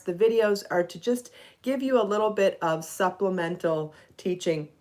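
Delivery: usual speaking voice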